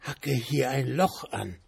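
soft, eerie, coaxing command; ghostly whisper. A narrator's reading. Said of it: "deutete auf das Werkzeug und flüsterte".

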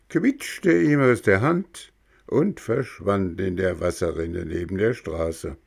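plain reading voice

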